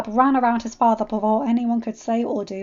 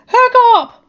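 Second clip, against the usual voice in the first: high, very loud call; alarmed, high-pitched yell; urgent shout; 13 semitones higher, 16 dB louder than usual. A narrator's reading. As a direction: call out to him at full voice. Father calls out as he rushes.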